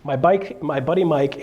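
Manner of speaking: ordinary delivery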